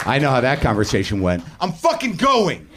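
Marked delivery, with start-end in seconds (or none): 1.6-2.7 high, drawn-out exasperated yell; exploding in anger. Acted. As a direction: act out a husband's worn-out outburst. Acts out writer's angry conversation.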